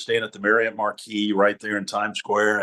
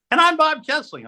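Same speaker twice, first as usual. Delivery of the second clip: scared high voice; 4 dB louder than usual. Retold or imitated. Rookie anchor squeaks his name beside a legend. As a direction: voice cracking way up high with nerves. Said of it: "I'm talking about the octaves too. I'm scared".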